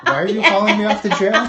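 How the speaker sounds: high, loud, innocent mock outrage; mock oblivious protest. Mocking a ticket dodger caught, high and loud. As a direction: squeal it like a clueless scofflaw. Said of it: "it's like".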